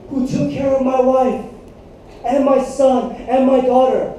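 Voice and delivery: normal talking voice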